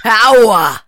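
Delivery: hammed-up gravelly boom; Al Pacino impression. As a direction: boom the word out like late-career Pacino. Mimics late Pacino's booming voice.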